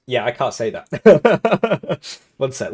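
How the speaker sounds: ordinary talk, nothing marked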